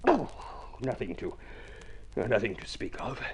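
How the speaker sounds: soft, croaky voice nearly gone; hoarse from a cold. Acted. Judd, asked if he caught a cold, answers hoarse and soft. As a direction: speak through a sore throat, barely there. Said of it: "Caught a cold?".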